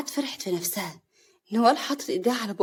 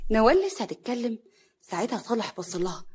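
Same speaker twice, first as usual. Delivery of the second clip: ordinary delivery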